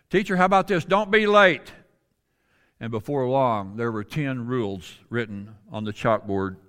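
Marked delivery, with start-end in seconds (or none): 0.1-1.7 higher and louder, pitching a rule to the class; eager student calling out. Retold or imitated. Another student calls out a rule to the teacher, higher and louder. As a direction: throw the idea up eagerly.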